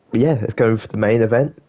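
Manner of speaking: normal talking voice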